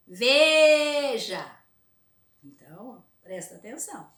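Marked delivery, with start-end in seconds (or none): 0.1-1.5 Veja with VE held high and loud; stress on VE. Shown as a demonstration. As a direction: call out Veja, holding VE. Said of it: "Chama sempre a palavra assim em voz alta".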